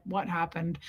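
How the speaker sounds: low, soft question from the mouth's corner; side-of-mouth aside. Retold or imitated. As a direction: ask covertly out of the side of your mouth. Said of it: "talk out of the side of your mouth".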